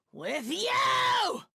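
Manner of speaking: spring it on him, screaming; vengeful shout. Acted. Villain turns on her victim.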